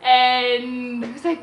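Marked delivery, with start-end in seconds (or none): 0.0-0.0 tearful joy. Retold or imitated. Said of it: "but I just cried".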